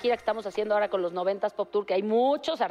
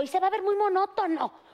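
high mocking retort; 7 semitones higher than usual. Answering Daniela's idea in a high mocking voice. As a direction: scoff at the idea in a raised voice. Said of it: "Y yo".

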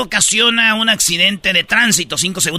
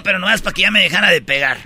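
ordinary talk, nothing marked

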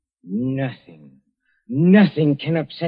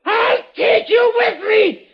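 crazed shouting threat; 14 semitones higher than usual. Acted. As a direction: scream it like a madman dragging them down. Dying man threatens to take everyone.

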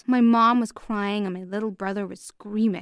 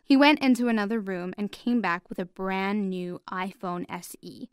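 usual speaking voice

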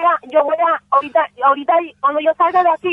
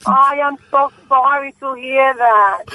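usual speaking voice

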